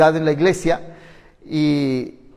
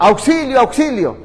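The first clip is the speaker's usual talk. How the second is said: higher, urgent call of auxilio; urgent cry for help; 7 semitones higher than usual. Shown as a demonstration. Preacher contrasting an urgent cry, higher and pressing. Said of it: "Se oye diferente".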